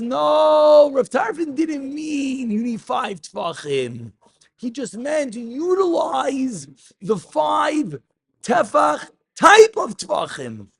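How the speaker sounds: high, emphatic answering voice; voicing the answer. Teacher acting out the Gemara's answer, high and emphatic. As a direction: pitch it way up as the Gemara's reply.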